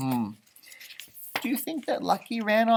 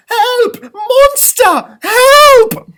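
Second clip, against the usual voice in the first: high, panicked yell; loud, high-pitched cries of alarm; crying for help; 15 dB louder, much slower than usual. A narrator's reading. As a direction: shriek for help as if chased by a monster. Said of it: "The professor cried".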